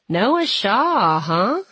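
needling a sibling, drawn out and lilting; teasing. A narrator's reading. Brother pries about Noah Shaw, teasing.

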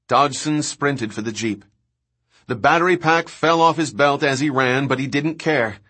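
ordinary delivery, nothing marked

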